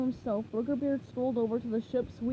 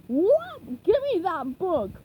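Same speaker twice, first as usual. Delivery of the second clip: higher, louder, slow squawk; grabby seagull; 6 semitones higher, 7 dB louder, much slower than usual. Acted. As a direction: squawk it greedily. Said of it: "the seagull squawked".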